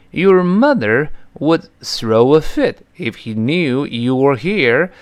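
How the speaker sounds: careful slow demo sentence; slow model reading. Shown as a demonstration. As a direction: read the English example slowly and clearly. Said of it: "英语例句慢读". (慢速示范朗读例句)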